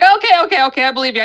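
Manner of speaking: high, loud rushed okays, giving in; frantic okays. Real, not acted. Creature appears between them, high frightened okays. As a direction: blurt it out as the thing appears.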